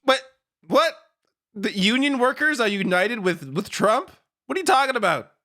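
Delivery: incredulous. Real, not acted. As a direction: question it in baffled disbelief. Incredulous reaction to the clip's claim.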